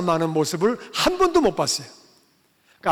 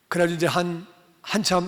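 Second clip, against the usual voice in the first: usual speaking voice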